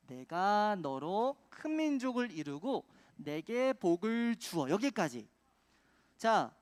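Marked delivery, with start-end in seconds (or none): 0.1-4.7 slow, emphatic chant reading; big voice. Shown as a demonstration. Preacher leads children reading chant verse, big voice. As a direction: lead the group through the verse slowly. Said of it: "큰 목소리로 챈트 말씀 읽어보는 거예요".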